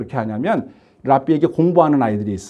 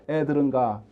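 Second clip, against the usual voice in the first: dismissive disciples; 6 semitones lower, 6 dB quieter than usual. Retold or imitated. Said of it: "제자들은 늘상 하던 대로".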